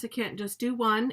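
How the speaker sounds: ordinary talk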